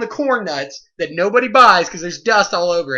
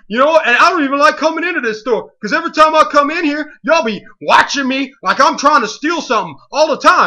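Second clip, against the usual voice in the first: put-on louder, higher complaining teen; mock aggrieved teen; 5 semitones higher, 4 dB louder than usual. Teen complaining the clerks watch him, hammed-up grievance. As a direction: whine like you're always picked on. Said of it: "and he's like".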